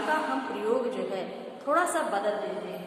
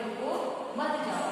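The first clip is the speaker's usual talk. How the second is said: रुको, break, मत जाओ as one unit; pause after रुको; 3 semitones higher than usual. Shown as a demonstration. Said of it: "अर्थ स्पष्ट हो जाता है". (रुको के बाद विराम, फिर मत जाओ)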